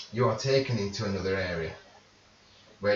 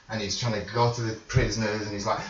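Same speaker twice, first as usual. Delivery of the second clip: ordinary delivery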